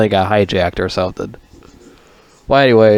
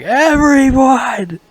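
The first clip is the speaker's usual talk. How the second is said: high, slow, drawn-out mock yell; imitated shout; 5 dB louder, much slower than usual. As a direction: stretch the word into a big movie-villain yell. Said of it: "So he shouted that shit".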